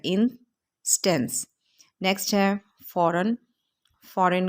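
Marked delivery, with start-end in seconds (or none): 0.0-1.5 instance slowly, in two beats, in-stance; split into syllables. Shown as a demonstration. Teacher shows how we say it, instance split as in, stance. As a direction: pause between the two halves of instance. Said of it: "इसके दो syllable हैं".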